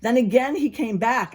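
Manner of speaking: ordinary delivery, nothing marked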